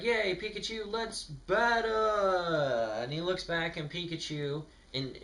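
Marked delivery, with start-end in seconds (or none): 0.0-2.9 high, eager cheer for battle; excited Ash. Retold or imitated. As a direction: squeal it like a kid ready to fight. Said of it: "Ash is like".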